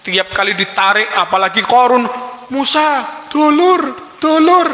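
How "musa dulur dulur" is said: high, pleading cry of "brother"; desperate begging. Acted. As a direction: beg for your life. Qarun begs Musa as brother.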